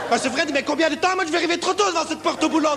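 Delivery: high anxious rambling; panicky rant. Acted. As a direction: fret about being early.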